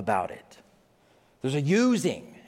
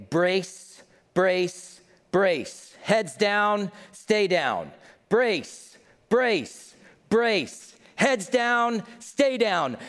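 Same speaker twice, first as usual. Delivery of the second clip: flight attendant brace command; 6 semitones higher, much slower than usual. Retold or imitated. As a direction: bark the brace call like crew in an emergency. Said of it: "they will say".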